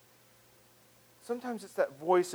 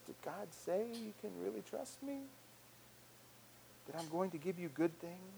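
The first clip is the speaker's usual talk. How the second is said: insinuating doubt; 2 semitones higher, 15 dB quieter than usual. Acted. Selfishness questioning God's promise, quietly doubting. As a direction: softly make trust sound doubtful. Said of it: "It's that voice that says".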